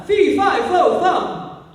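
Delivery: fairy tale giant chant; fee fi fo fum. Shown as a demonstration. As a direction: boom it like the giant. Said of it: "everyone do this".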